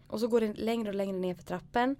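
ordinary delivery

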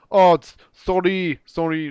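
put-on loud French-accented apology. Thief giving the phone back, put-on French accent. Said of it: "That's my French accent".

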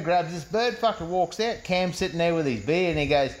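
ordinary delivery

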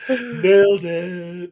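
slow, laughing drawn-out catchphrase; laughing slow mock praise; laughing nailed it. Real, not acted. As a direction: savor it through laughter.

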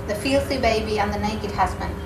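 ordinary delivery

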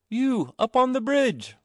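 high, slow, distant sing-song reply; maidens answering. A narrator's reading. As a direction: answer like water maidens from below. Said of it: "They answered".

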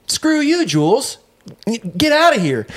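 high-pitched imitated outrage; mock angry boss. Boss telling off his salesman, high mock anger. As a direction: throw him out in a high mock fury. Boss curses out employee, retold.

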